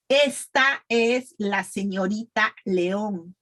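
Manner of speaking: slow, evenly spaced model sentence; pronunciation practice. Shown as a demonstration. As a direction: say it slowly for learners to repeat. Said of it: "practice with me, please".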